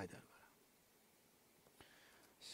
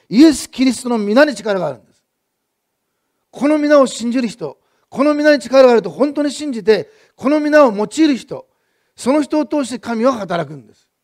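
louder, higher preaching at full force, slow on the key phrases; fervent preaching; 6 semitones higher, 11 dB louder than usual. Acted. Pastor proclaiming to the congregation, fervent full-force preaching. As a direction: drive the point home to the congregation.

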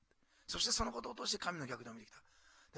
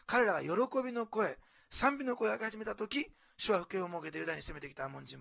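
loud, higher-pitched scripture proclamation; forceful preaching; 4 semitones higher, 7 dB louder than usual. Real, not acted. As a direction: proclaim the victory emphatically to the congregation. (力強く声を張った説教)

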